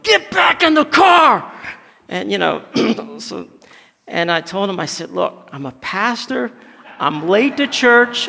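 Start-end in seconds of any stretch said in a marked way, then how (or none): 0.0-1.5 officer's shouted order, high and drawn out; instant yell. Retold or imitated. Police officer to driver jumping out of car, yelling. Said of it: "he instantly yelled". 5.1-8.3 giving merits. Retold or imitated. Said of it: "giving him all of my merits".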